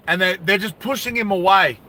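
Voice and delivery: usual speaking voice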